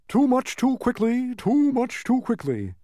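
high, loud wheezy bug; high, strained overfull protest; uncomfortable wheezing. A narrator's reading. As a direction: wheeze it between gulps. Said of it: "wheezed the uncomfortable bug between gulps".

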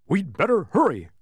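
loud, high urgent bark; barking Tok. A narrator's reading. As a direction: bark the warning like a dog. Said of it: "barked Tok".

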